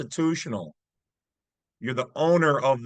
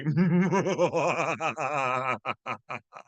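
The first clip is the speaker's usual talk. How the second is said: slow, sinister cackling laugh; a drawn-out villain's cackle; evil villain laugh. Acted. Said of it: "That's my evil villain laugh".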